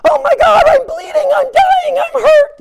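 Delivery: hammed-up terrified wail over a cut finger; panicked cry. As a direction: scream it like you're bleeding out. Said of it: "what most people do is".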